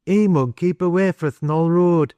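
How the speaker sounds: put-on high-pitched boy's dialect warning; insistent. A narrator's reading. Boy warns man away from the road, insistent. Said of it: "persisted Jud".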